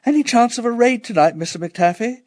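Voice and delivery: put-on high, quick child's call. A narrator's reading. Child calling out to the warden in the street. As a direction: call out eagerly, high and fast. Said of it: "I'd call out".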